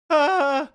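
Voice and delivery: high loud rubber chicken squeal; rubber chicken squawk. Shown as a demonstration. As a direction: another rubber chicken cry. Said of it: "That's me making the rubber chicken noise".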